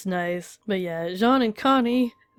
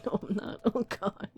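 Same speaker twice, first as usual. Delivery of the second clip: teary whisper; 7 dB quieter than usual. Real, not acted. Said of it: "Tearing up more than the first time".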